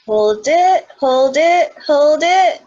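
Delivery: rising strained voice, breath held; holding breath. Shown as a demonstration. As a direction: speak while holding breath in. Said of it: "we'll hold the breath up".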